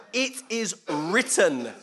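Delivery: slow, loud, ringing declaration; emphatic rebuke. Acted. Preacher voicing Jesus rebuking Satan, emphatic. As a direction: hurl the verse slowly at the enemy.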